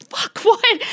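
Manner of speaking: high-pitched imitation of a yelled reply; startled husband. Husband answering his wife's yell, imitated startled shout. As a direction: call back high, as a comically alarmed husband. Husband yells back when summoned.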